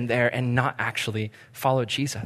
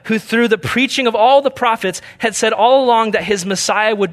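raised, louder bible reading to the congregation; scripture reading; 6 semitones higher, 4 dB louder than usual. Acted. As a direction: read the passage out with preacher's lift. Reads scripture aloud.

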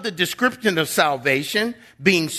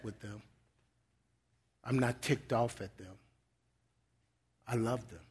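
much softer, tender and patient; gentle voice of the Lord; 6 semitones lower, 9 dB quieter than usual. Acted. The Lord correcting angry believers, quiet and tender. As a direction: hush down and speak like a loving father. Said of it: "the Lord has to tap us on the shoulders and say".

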